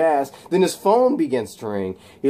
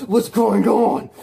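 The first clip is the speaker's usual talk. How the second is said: higher, louder and slowed, a panicked plea; mock panic, pitched up; freaking out; 9 semitones higher, 5 dB louder, slower than usual. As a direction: voice him losing it in fear. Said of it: "he begins freaking out".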